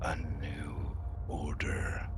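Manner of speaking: low, breathy ghost whisper; raspy ghostly voice. Acted. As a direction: rasp it out like a ghost. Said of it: "in a kind of raspy, ghostly voice".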